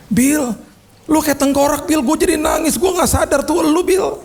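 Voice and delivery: high-pitched voice of an overwhelmed friend; emotional friend. Retold or imitated. Friend confessing to sick Bill that he cried, high-pitched and emotional. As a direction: act out a friend's distress, pitched well up.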